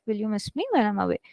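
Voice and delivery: hushed, rapid private read; read to oneself. Shown as a demonstration. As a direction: say it fast and low-key, as if only for yourself. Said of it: "Just read it to yourself".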